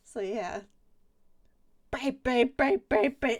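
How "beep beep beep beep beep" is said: loud, higher, repeated beeps; mock detector alarm. Playing the ass detector going off, loud mock beeping. It should have been beeping.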